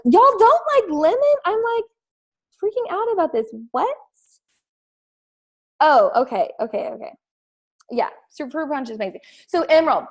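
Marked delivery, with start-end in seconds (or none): 0.0-3.9 excited disbelief. Real, not acted. Reacting to comment about lemon.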